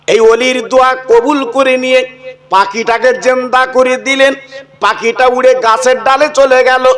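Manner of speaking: lilting, chanted preacher's telling; sermon storytelling. Acted. Preacher telling the crowd a saint's tale, chanted sermon voice.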